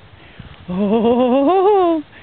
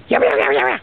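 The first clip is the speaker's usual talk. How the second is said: yapping; 10 semitones higher, much faster than usual. Real, not acted. Yapping at animals.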